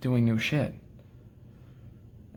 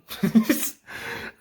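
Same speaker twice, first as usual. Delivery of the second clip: breathy laugh; 8 semitones higher than usual. Real, not acted. Laugh after exasperated outburst.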